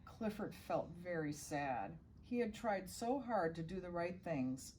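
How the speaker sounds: ordinary delivery, nothing marked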